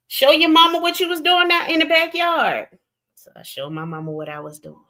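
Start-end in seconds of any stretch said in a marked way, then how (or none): 0.1-2.7 loud, high mock command; imitating a tattling cousin. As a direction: call it out like a cousin telling on her. Acts out the cousin telling on her.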